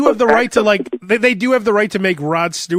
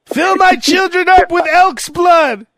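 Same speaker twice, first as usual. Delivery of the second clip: pompous royal; 10 semitones higher, 3 dB louder than usual. Pretend. William voiced as a bloodthirsty royal.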